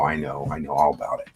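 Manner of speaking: hushed, soft whisper. Acted. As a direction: drop to a whisper. Whispered aside about spaghetti.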